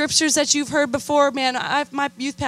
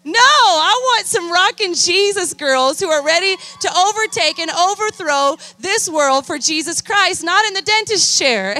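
higher and louder, calling out the youth; fired-up preaching; 7 semitones higher, 4 dB louder than usual. Real, not acted. Preacher rallying teen girls against dental school, loud and high.

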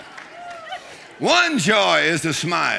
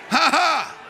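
high, shouted ha-ha; triumphant forced laugh, pitched way up; defiant faith laugh; 11 semitones higher than usual. Shown as a demonstration. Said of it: "I laugh at impossibility".

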